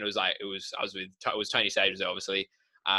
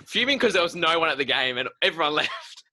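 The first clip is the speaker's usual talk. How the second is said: laughing; 8 semitones higher, 4 dB louder than usual. Real, not acted. Laughing while telling funny story.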